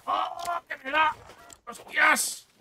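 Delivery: high startled yelps; excited alarm. Real, not acted. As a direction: blurt it out in alarm. Attacked suddenly in game.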